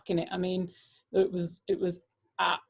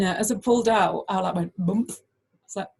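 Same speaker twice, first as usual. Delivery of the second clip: ordinary delivery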